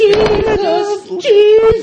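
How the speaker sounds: high, drawn-out groan of disbelief; high-pitched exasperation. Real, not acted. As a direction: squeak out a disbelieving Jesus. High exclamation at the table.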